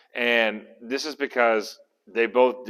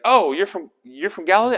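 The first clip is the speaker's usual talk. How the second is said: higher-pitched, halting realization; mock surprise; 7 semitones higher, 4 dB louder than usual. Pilate finding out Jesus is Galilean, surprised. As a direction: act taken aback, pitch lifted.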